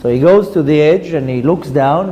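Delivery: ordinary delivery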